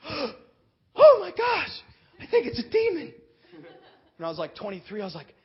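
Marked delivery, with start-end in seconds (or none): 0.1-3.1 high, loud, slow gasp of alarm; panicked. Retold or imitated. Young pastor panicking as the student convulses. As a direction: realize it's a demon, alarmed.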